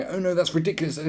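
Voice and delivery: high, loud mock whine; sneering high voice of an offended pet owner; mocking objector. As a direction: parody a whiny objector.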